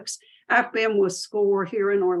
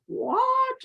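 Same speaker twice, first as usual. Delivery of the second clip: a hint of a baffled high-pitched what; mock confused what; puzzled what. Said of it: "you're like".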